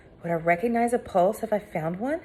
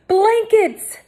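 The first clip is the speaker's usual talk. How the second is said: high loud urgent call; shouted; 8 dB louder than usual. A narrator's reading. Hector shouting for blankets for the girls. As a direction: order it urgently. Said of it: "Hector shouted".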